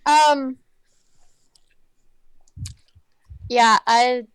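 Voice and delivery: talking while chewing gum. Real, not acted. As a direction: chew gum as you speak. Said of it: "Can you stop chewing gum?".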